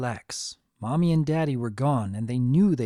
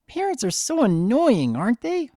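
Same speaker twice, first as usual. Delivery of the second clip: high filly voice, complaining; young filly; 10 semitones higher, 4 dB louder than usual. Acted. Filly complaining to the twins about her parents, high pitched. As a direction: roll your eyes at grown-ups.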